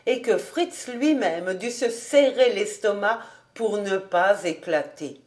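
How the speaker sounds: ordinary delivery, nothing marked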